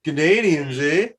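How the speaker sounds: lightly hammed Canadian accent; mock Canadian. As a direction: tease with a Canadian "eh". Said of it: "talking about Canadians".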